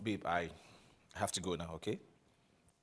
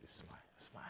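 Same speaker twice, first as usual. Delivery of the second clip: soft coaxing whisper; 12 dB quieter than usual. Acted. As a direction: gently coax a smile. Coaxing her to smile.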